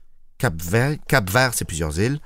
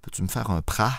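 softer mock-desperate plea; mock despair; 3 semitones lower, 7 dB quieter than usual. Asking Fred for a loan, softer hammed-up despair. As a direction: beg quietly, hamming up the misery. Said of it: "le désespoir dans la voix".

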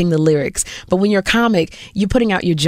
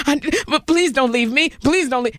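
high-pitched begging; hammed-up desperate plea; jilted lover; 8 semitones higher than usual. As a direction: beg like someone being dumped. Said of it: "like a boyfriend who doesn't wanna be with me".

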